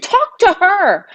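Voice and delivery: very high, slow and emphatic; high, drawn-out retold command; retold urging. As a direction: urge him hard, drawing out the words. Acted confrontation with her brother.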